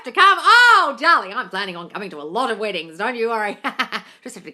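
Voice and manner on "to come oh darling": high, loud, building to a squeal; delighted gushing. Real, not acted. Coach thrilled by a follower's wedding invitation, delighted gushing. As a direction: gush with rising excitement.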